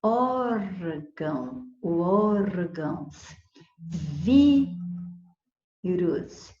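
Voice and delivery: "Órgão" and "órgãos" drawn out slowly; slow demo. Shown as a demonstration. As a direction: pronounce each word slowly for students. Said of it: "teacher spells out examples". ("órgão, órgãos" bem devagar)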